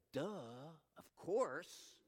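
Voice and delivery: drawn-out mock "duh"; retold self-mocking realization. Husband to himself once wife explained, self-mocking realization. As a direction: act out the obvious finally dawning. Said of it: "and I went".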